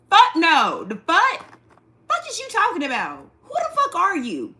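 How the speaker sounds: loud, high, indignant yelling with a laugh; outraged shout. Real, not acted. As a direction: blow up at the comment. Outraged at chat comment.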